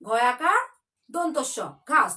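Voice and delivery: ঘয়াকার said loud and set apart, then দন্তস্য ঘাস; spelled piece by piece. Shown as a demonstration. Teacher shows pupils how to spell ঘাস in broken, loud pieces. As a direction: chop the spelling into pieces for the class. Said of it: "কেটে কেটে বানান করি".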